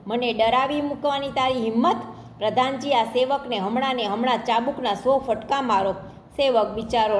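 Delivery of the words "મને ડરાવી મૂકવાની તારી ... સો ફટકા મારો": plain reading voice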